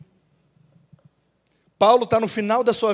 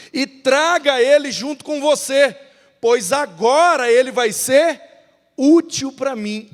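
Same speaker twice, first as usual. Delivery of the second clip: Paulo pedindo com ênfase; 5 semitones higher, 6 dB louder, slower than usual. Acted. Paulo pede Marcos a Timóteo.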